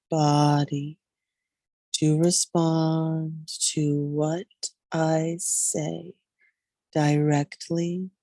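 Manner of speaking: slow, drawn-out meditation voice with long pauses; guided Yoga Nidra meditation. Real, not acted. Yoga guide leading listeners into deep rest, slow meditative delivery. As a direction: ease them into rest, stretching the words slowly.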